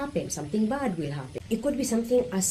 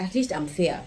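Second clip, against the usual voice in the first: ordinary talk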